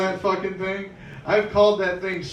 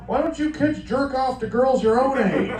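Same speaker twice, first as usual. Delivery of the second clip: mock grown-up scolding; 9 semitones higher, 5 dB louder than usual. Parent scolding kids in story.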